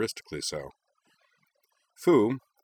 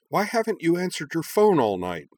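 higher, sharp reproach; snapping; 10 semitones higher, 4 dB louder than usual. Acted. Hikaru snapping at Nal for ignoring his phone. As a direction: bite the question off at him. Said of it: "Hikaru snapped".

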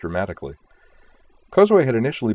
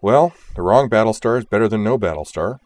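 louder, resigned acceptance; a touch of wry making-do; philosophical; 3 semitones higher, 5 dB louder than usual. A narrator's reading. Leader settles for another Battlestar, philosophical. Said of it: "Kozue said philosophically".

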